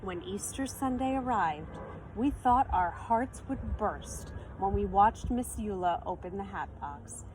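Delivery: ordinary delivery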